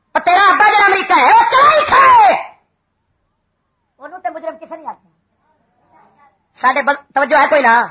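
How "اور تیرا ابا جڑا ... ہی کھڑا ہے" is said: high-pitched preacher's taunt; taunting shout. Real, not acted. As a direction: jeer it out to the crowd. Preacher taunting opponents about America.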